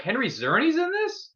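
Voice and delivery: a delighted can't-believe-it question; surprised. Retold or imitated. Fan reacting to a casting surprise, high and astonished. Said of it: "When I saw him, I was like".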